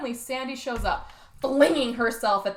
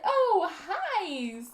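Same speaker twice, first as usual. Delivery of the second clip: chirpy date; 8 semitones higher than usual. Retold or imitated. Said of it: "she's like".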